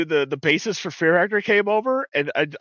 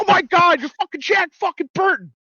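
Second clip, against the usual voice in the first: very high, loud starstruck shriek; hammed-up fanboy meltdown; overexcited fan; 14 semitones higher, 4 dB louder than usual. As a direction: squeal it at your movie hero. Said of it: "the overexcited guy".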